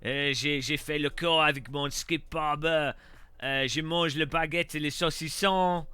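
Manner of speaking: hammed-up French, comic and showy; mock French accent. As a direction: put on a jokey, overdone French accent. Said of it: "My French accent sounds like Bill Bailey taking the piss".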